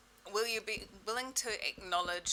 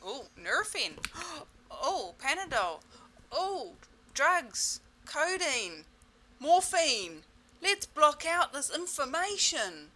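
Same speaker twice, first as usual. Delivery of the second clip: high, louder, theatrical relief; mock relieved; 7 semitones higher, 6 dB louder, slower than usual. Person grabbing painkillers to block the pain, hammed-up. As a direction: ham up reaching for pills.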